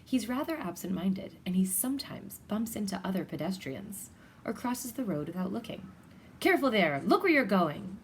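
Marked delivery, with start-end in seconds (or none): 6.4-8.0 loud, sharp warning shout; cyclist shouting. Acted. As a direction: yell it at a careless walker. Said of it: "shouts a cyclist".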